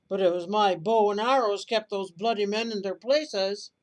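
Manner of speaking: shouting robber in a brawl; furious shout. Acted. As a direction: bellow it out, claiming the credit. Robbers quarrel over the money.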